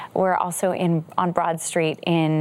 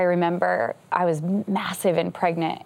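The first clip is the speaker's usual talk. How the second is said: ordinary talk, nothing marked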